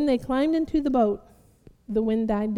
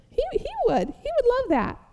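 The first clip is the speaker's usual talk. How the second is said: playful high voice; 11 semitones higher than usual. Acted. Playful aside about God's delight.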